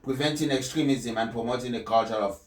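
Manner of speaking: measured, solemn address read from the text; formal speech reading. Acted. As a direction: deliver it like a papal address. Reads the Pope's speech aloud.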